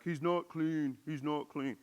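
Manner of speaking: a light Trump impression, repeating himself; a hint of Trump repeating the point. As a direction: lightly do Trump, saying it twice. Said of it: "I could not not read that in the Trump voice".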